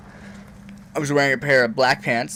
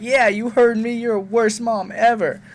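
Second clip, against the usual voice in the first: high, louder bratty yelling; mock angry teen; 11 semitones higher, 5 dB louder than usual. Teen yells at his mom, high and bratty. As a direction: throw the insult back hard.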